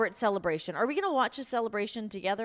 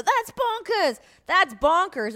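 exaggerated American accent, pitched up and loud; loud, mocking non-British delivery; 7 semitones higher, 6 dB louder, slower than usual. Shown as a demonstration. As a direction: blurt it out in plain American. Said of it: "without an English accent, I'll go".

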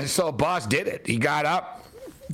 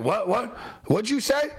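mock threatening what; 7 semitones higher than usual. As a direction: pitch climbing higher on each what. Boss Rutan confronting Cam.

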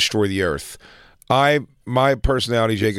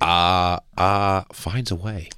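slow, lower halting drawl; Jeff Goldblum impression; 6 semitones lower, much slower than usual. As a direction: stammer it slowly, lower. Slow low imitation of quote.

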